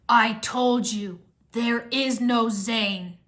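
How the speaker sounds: very slow, forceful villain voice; cold emperor. A narrator's reading. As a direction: pronounce it slowly, as a ruler would. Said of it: "the Ice Emperor said".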